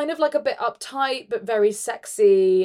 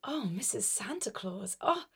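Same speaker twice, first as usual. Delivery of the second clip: soft seductive voice; 10 dB quieter than usual. Acted. Elf seducing Mrs. Claus.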